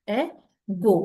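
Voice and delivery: "Ego" as two beats, E, then go; split into syllables. Shown as a demonstration. Teacher confirming the two-syllable answer, "Ego" broken in two. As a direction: pause inside "Ego" before the go. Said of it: "is two syllable word".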